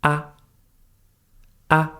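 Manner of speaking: Ah short and very soft; a brief, light Ah with no glide; soft neutral tone. Shown as a demonstration. Teacher showing learners the neutral tone, Ah short and soft. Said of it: "the last one is very soft".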